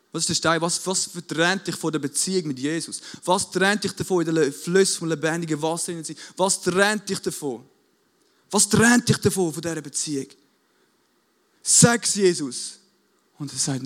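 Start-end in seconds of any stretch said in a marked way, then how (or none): 3.2-10.3 raised, insistent challenge to the congregation; impassioned rhetorical questioning; urgent preaching appeal. Real, not acted. 11.6-12.8 loud, high, slow command; emphatic urging from the pulpit; forceful exhortation. Real, not acted. Preacher exhorts the congregation.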